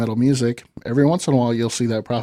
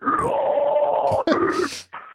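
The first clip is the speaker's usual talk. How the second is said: long growled death-metal roar; sustained guttural metal scream. Shown as a demonstration. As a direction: roar it out on request, no words. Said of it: "could you do that one more time".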